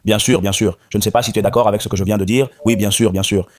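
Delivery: ordinary talk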